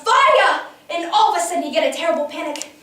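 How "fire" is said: high, loud alarm cry; acted yell. Someone raising the alarm in the factory, yelling. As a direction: shout it out to warn everyone. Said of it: "Then somebody yelled".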